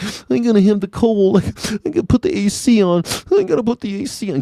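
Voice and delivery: high-pitched mock whining; whiny overheated guy. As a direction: whine like a big baby about heat. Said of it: "guys are such pussies when it comes to the heat".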